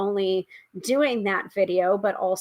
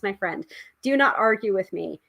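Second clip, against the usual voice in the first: ordinary delivery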